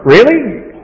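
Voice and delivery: sarcastic surprise; mock disbelief. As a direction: pretend to be amazed. Mocking a literal reading.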